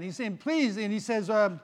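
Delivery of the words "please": high pleading please; begging. Acted. Man begs Saint Peter to let him in, pleading. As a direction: beg for mercy. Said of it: "he's begging again".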